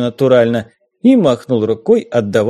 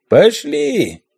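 high creaking ghostly bark; creaky ghost; 9 semitones higher than usual. A narrator's reading. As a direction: creak it out like a wooden-faced ghost sailor. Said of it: "проскрипел он".

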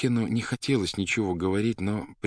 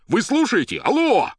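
very high, loud yell; shouting; 9 dB louder than usual. A narrator's reading. Poet checks the phone line, shouting. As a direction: yell to get the operator's attention. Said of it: "а потом опять закричал в трубку".

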